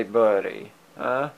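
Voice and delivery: ordinary delivery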